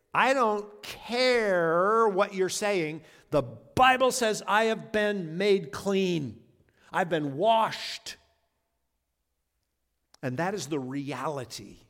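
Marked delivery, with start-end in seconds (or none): 0.1-8.2 slow, louder defiant words to your feelings; defiant declaration. Pretend. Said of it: "look your feelings right in the eye and say".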